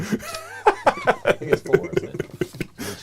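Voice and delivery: slow, high laughing words. Real, not acted. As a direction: squeak it out through laughter. Laughing while speaking.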